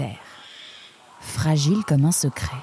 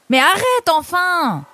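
high, drawn-out exasperated outburst; 13 semitones higher, 4 dB louder than usual. Acted. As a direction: beg the teasing friend to quit, slowly. Nemrod exaspéré par les taquineries. (exaspéré, aigu et traînant)

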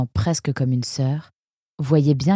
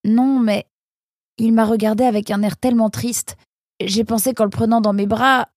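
put-on higher, earnest young fox; higher voiced Nemrod explaining; Nemrod's voice; 7 semitones higher than usual. A narrator's reading. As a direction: plead your case gently. Nemrod defends himself.

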